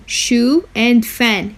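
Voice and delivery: شوفان split into "Shu" and "fan", slowly; slow syllable-by-syllable Shu, fan. Shown as a demonstration. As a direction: say شوفان in two slow beats. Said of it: "Here you can divide it into two".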